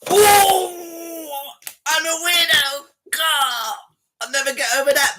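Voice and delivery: loud, high fake crying; mock wail. Streamer pretends the caller's insult devastated him, mock wailing. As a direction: wail as if wounded, clearly putting it on. Feigns hurt at being called weirdo.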